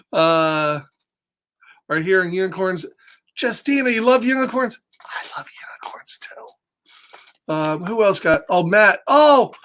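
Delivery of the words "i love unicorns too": soft whispered aside; hushed confiding whisper. Real, not acted. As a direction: lean in and whisper it.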